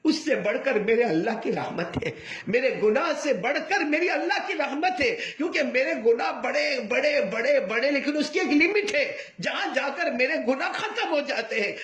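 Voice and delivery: high, sobbing cry of hope; father sobbing as he declares God's mercy. Acted. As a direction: cry it out through tears, pitched high. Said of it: "باپ نے زور سے چیخ ماری".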